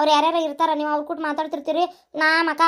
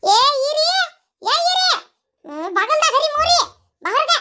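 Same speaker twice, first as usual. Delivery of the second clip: mother-in-law calling; 11 semitones higher, 4 dB louder, slower than usual. Acted. Mother-in-law calls daughter-in-law.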